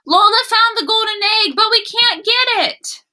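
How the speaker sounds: high, loud call to a friend. Acted. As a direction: call across to Wally. Gilbert calls to Wally.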